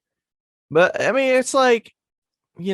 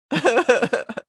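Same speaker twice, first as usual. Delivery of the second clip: laugh; 13 semitones higher, 4 dB louder than usual. Real, not acted. Laughs while the host is away.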